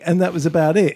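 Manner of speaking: normal talking voice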